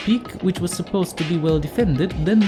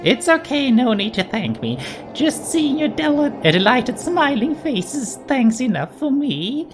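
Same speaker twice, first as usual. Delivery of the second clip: higher, sing-song mascot voice; Monokuma voice; 5 semitones higher than usual. Acted. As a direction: play a gleeful cartoon bear. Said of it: "Monokuma told us".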